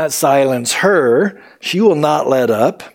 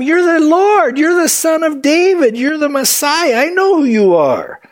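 high, urgent acted cry; 11 semitones higher than usual. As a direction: cry out in a much higher voice, dropping at the end. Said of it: "she's crying out to him".

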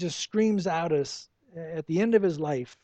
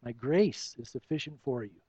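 God's calm answer; 8 semitones lower, 5 dB quieter than usual. Retold or imitated. God answering Paul's plea, lower softer calm reply. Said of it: "and God says".

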